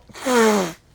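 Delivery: loud, forceful exhale; real, heavy sigh into the mic. Real, not acted. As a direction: let out a big sigh. Pauses before resuming the story.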